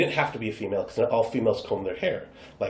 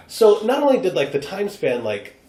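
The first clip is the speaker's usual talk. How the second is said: usual speaking voice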